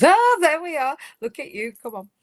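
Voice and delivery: put-on high, laughing dad cheering up his fallen kid; jolly dad. Dad jollying along a toddler who fell, high and laughing. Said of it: "if dad comes along and has a laugh and goes".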